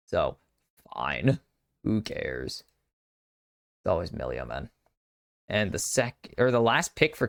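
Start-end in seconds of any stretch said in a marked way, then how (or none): 0.1-4.7 low, deflated "who cares" sigh-talk; resigned grumble. Real, not acted. Caster fed up that it's always Millia, grumbling low. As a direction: sound fed up with the same pick. Resigned complaint about the same pick.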